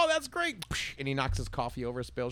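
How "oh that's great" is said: very high, gushing reaction; delighted customer. Retold or imitated. Customer praising the sandwich, very high and gushing. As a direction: gush over it like a cartoon. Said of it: "then he's like".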